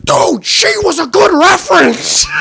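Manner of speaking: very high screamed outrage; retold scream. Friend screamed at us while driving, high outraged scream. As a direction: imitate a friend screaming, pitch shot way up. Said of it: "screamed at us".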